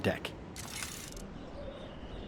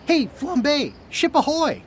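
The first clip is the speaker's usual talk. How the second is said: calling out; 13 semitones higher than usual. Real, not acted. Calling up the mast.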